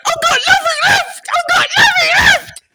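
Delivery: piercingly high hammed-up wail; Ian Beale. Impressionist accosting a lookalike, shrieking soap character impression. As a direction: shriek it in loud, squeaky despair. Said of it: "looked vaguely like Ian Beale".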